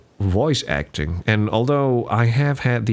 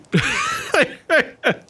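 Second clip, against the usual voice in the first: squealing laugh at himself; high-pitched laugh. Real, not acted. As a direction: burst into a high giggle. Laughing at his own attempt.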